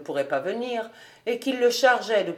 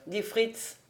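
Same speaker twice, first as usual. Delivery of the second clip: usual speaking voice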